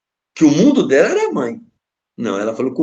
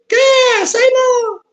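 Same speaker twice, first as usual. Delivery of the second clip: much higher, louder mock woman's voice; imitating a shrill woman; 6 dB louder, slower than usual. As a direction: call out like a simple old lady. Voz de casa, aguda. (voz de mulher aguda, imitada)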